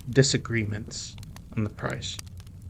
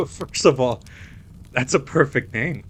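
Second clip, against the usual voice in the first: laughing through first of all; 3 semitones higher, 5 dB louder than usual. Real, not acted. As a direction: laugh at the name. Laughing at the nickname.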